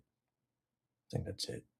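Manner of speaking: slow breathy whisper. Real, not acted. Wrapping up the video, whispered softly to himself. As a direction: whisper it, trailing off.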